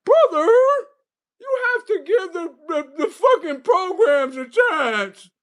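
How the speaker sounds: hammed-up sobbing, begging his brother; mock crying plea. [name] begging his brother in a hammed-up sob.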